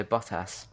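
short clipped-off BOT in Bottas; British pronunciation. Shown as a demonstration. Brit shows American friend how to say it, Bottas as bot-ass. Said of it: "How do you say it?".

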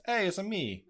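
high, bouncy mock Italian accent with a laugh; jokey Mario-style Italian accent. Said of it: "when he goes".

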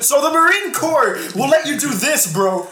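mock recruiter. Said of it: "five seconds in".

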